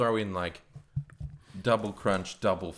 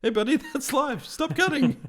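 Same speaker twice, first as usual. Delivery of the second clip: high-pitched, laughing through it; squeaky alarmed bystander, half laughing; high laughing warning. Retold or imitated. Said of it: "someone's like".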